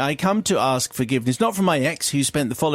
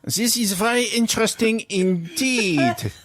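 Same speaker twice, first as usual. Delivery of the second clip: put-on German accent; 6 semitones higher than usual. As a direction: ham up a stiff German interviewer. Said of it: "it demands the German accent".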